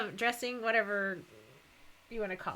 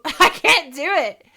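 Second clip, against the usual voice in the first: ordinary talk, nothing marked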